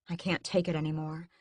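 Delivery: ordinary delivery, nothing marked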